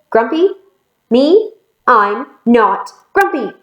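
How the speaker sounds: high incredulous questions, then firm protest; indignant denial. Acted. Grumpy monkey indignantly denies being grumpy.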